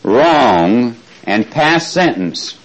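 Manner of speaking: slow, spaced dictation pace. Shown as a demonstration. Teacher dictating to the class, slow and spaced. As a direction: read it out slowly so they can write it down. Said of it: "write where it says judge against".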